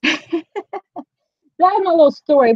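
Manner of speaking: laughing through the wrap-up. Real, not acted. Vlogger finishing kombucha story for viewers, laughing. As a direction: chuckle as you close the tale.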